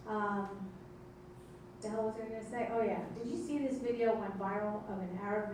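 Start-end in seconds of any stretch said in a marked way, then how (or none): none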